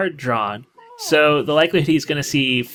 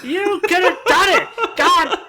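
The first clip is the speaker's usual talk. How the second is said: much higher, frustrated cry at the player; exasperated outburst; 11 semitones higher than usual. Real, not acted. Commentator venting at Sebastian for not running, exasperated. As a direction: throw up your hands at a missed chance.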